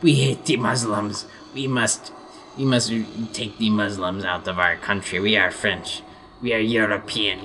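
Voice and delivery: hammed-up French accent; mock French accent. Frenchman rants about foreign cultures, mock French accent. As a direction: play a xenophobic Frenchman, French accent laid on. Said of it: "I'm doing some joking imitations".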